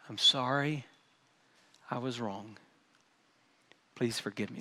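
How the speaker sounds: soft, humble, quiet apology; mean every word, gently; sincere apology. Shown as a demonstration. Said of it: "I'm gonna say them first".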